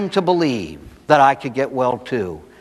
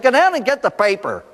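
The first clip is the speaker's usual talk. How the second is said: higher-pitched, insistent mother's command; exasperated order; 8 semitones higher than usual. Retold or imitated. Mother finally ordering her son to fetch the paper, exasperated. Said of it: "finally the mother said".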